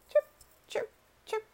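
high little bird chirps; robin chirps. A narrator's reading. Robin chirps three times at the lost pair.

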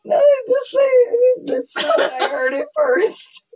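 slow, high, laughing through words; can't stop laughing. Real, not acted. Laughing at the innuendo.

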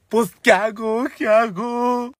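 high, hammed-up helpless plea; mock helpless wail. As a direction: wail it as if at a loss. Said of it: "empezó a llorar. Y yo".

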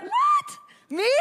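high, loud squeal of disbelief; incredulous. Retold or imitated. Woman protesting to God about being called competitive, incredulous. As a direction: act out shocked protest at being singled out. Acts shocked reply to God.

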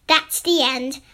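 plain reading voice

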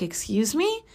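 retold baffled query; taken aback. Customer answering a stranger speaking French to her, taken aback. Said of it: "And I'm like".

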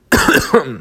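sudden cough from swallowing wrong; choking cough. Real, not acted. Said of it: "Ich hab mich grad verschluckt in meiner eigenen Spucke".

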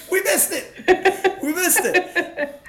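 slow, drawn-out words while laughing. Retold or imitated. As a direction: drag it out while cracking up. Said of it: "I was like".

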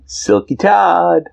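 drawn-out high sing-song. Acted. Insisting, drawn out.

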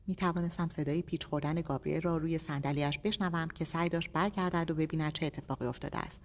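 usual speaking voice

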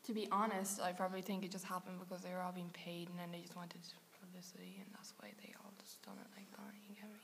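hushed conspiratorial whisper; conspiracy voice. Real, not acted. Podcaster sharing a celebrity publicity theory, in a conspiratorial whisper. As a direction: sink into a barely audible, secretive whisper. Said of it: "that was a conspiracy voice as well".

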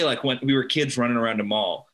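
ordinary delivery

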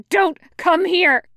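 high, loud screamed order. A narrator's reading. Pinned fighter warns companion off, screaming. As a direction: scream a desperate warning. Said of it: "he screams".

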